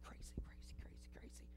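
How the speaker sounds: crazy fading into a whisper; whispered echo. Acted. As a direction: let it die away in a whisper.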